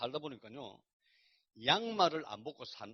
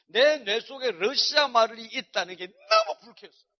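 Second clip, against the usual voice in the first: loud and higher, hammering each phrase; loud vehement outburst; 7 semitones higher, 15 dB louder, slower than usual. Real, not acted. Preacher confessing his grudge against Russia, loud and vehement. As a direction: declare it with full force, almost shouting.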